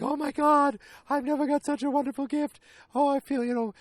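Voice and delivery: high-pitched mock gushing; gushing thanks. Holly thanking Carrie for gold earrings, gushing. Acted gushing over a gift.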